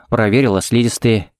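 ordinary delivery, nothing marked

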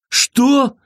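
high, panicked yelp of fear; man screams in terror at the sight of blood; terrified shriek. A narrator's reading. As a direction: shriek it in sheer horror. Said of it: "закричал Сэм дрожащим от ужаса голосом".

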